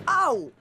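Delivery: sharp high cry of pain; pained yelp. Acted. Player yelps after missing the ball, sharp high cry. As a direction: yelp as if just hurt.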